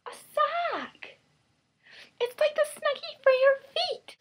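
cheesy salesperson voice. Pretend. Pitching her sweatpants to viewers as a hammed-up infomercial host. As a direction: sell the sweatpants like a peppy TV host. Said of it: "talking in this voice".

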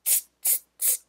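soft unvoiced teasing tss; monkey tsk sound. Monkeys mocking the peddler, soft voiceless tss. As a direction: hiss back like cheeky monkeys. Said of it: "and said".

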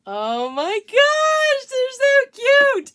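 high, loud, slow gushing. Real, not acted. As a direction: coo over how cute. Gushing over finished glasses.

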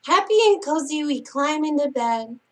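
singsong picture-book reading; bedtime story reading. Acted. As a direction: read it like a lullaby to sleepy kids. Reads bedtime picture book aloud.

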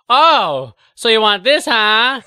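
loud, high, in-your-face jeer; mocking taunt. Trickster daring someone who made a wish, taunting. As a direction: needle them at full volume.

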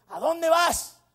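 high, loud put-on granny voice; demanding old lady. Old lady questions the driver, high and demanding. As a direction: call the question out sharply, pitched high.

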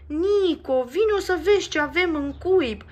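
loud, high excited call; proud shout. Acted. Max calls Nico to see the eggs, a loud proud shout. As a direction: call her over proudly. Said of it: "îi strigă Max".

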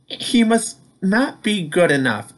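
lightly voiced Peter the truck; Peter's voice. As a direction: voice Peter a bit up. Peter voiced in read story.

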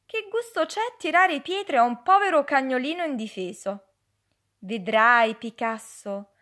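the sea consoling a little stone; sea comforting the stone. Acted. The sea speaks kindly to Picasso. (il mare che consola, voce piena)

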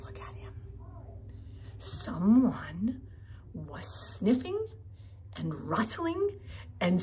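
soft hushed storytelling whisper; suspense whisper. A narrator's reading. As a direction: hush it, something is sneaking.

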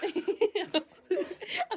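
words broken by laughter; laughing. Real, not acted. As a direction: laugh through the line.

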